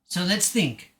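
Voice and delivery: usual speaking voice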